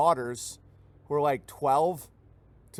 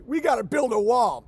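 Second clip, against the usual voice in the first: higher, louder mock conservative chant; conservative slogan; 7 semitones higher, 5 dB louder than usual. Neocon conservatives demanding a wall, mock rally voice. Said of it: "they're like".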